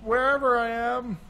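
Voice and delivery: mock dreamy tourist. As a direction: gush like a starry-eyed traveler. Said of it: "She's like".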